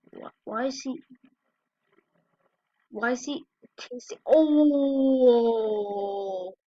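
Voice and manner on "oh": usual speaking voice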